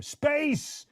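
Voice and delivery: excited call. Real, not acted. Greeting viewer by name.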